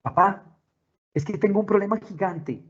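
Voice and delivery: put-on high-pitched child voice; child to parent. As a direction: play a child bringing dad a huge problem. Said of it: "tenemos ese tipo de prácticas con nuestros hijos".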